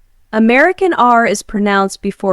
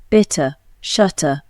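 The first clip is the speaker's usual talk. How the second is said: British accent, crisp clear T in "water, twenty, internet"; British accent: sound every T in "bitter" and "twenty"; British accent T demo; 2 semitones lower than usual. Shown as a demonstration. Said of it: "But in British English, we pronounce T".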